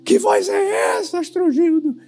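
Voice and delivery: high, panicky woman's voice; Cremilda's cry. Acted. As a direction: squeal it in mock alarm. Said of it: "E Cremilda grita".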